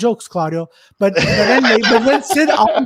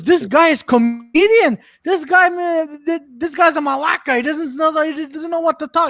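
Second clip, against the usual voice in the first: higher, louder mock outrage; acted indignant insult; 6 semitones higher, 3 dB louder than usual. Club owner ranting about an unfunny comic, mock indignant. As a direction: imitate an owner complaining in disbelief.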